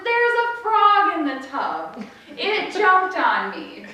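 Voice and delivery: put-on high, loud, slow wail of a frightened child; mock panic. Girl telling mom about the frog, panicked wail. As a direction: imitate a hysterical little sister. Screaming sister reports the frog.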